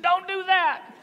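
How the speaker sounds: high mock alarm from the driver; high pleading good ol' boy; tow truck driver Bubba. Bubba pleads not to call mama.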